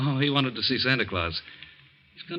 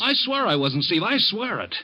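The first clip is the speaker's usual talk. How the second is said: higher, urgent swearing it; pleading oath; 5 semitones higher, slower than usual. Acted. Joe desperately swearing to Steve he never left the house. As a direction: beg him to believe you.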